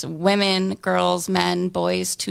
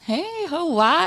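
high playful holler; playful whoop; 10 semitones higher than usual. Real, not acted. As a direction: toss out a happy little whoop.

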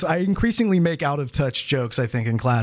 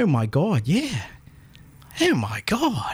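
exclaiming in Pittsburgh dialect; Pittsburgh accent; 2 semitones higher, slower than usual. Shown as a demonstration. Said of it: "that's the Pittsburgh accent?".

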